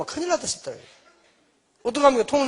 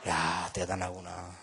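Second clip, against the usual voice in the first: drawn-out, soft amazement; quiet slow wonder; slow awed remark; 11 dB quieter, much slower than usual. Real, not acted. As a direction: marvel at it slowly under your breath. Awed at son singing boldly.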